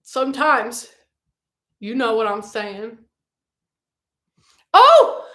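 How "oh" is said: high, loud startled "oh"; surprised cry. Real, not acted. Streamer spots a relative in the chat, surprised cry. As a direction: burst out in sudden surprise.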